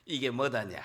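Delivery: low, lightly Jeolla-accented question; a hint of Jeolla lilt; Jeolla dialect. Shown as a demonstration. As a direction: model Jeolla speech for repeating. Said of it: "전라도 사투리 따라하면 돼".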